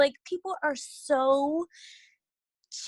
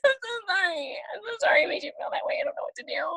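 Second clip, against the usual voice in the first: high, fast, breathy mock sorry; mock apology; 10 semitones higher, faster than usual. She mimics her own flustered apology to a friend. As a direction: play the meek apologizer, half laughing.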